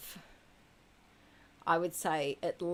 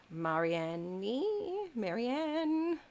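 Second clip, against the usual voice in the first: Marianne stretched out slowly in a tentative Dutch way; a careful go at "Marianne?" the Dutch way; Dutch-style Marianne; 6 semitones higher, much slower than usual. Shown as a demonstration. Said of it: "or do you say it in a more Netherlandy way".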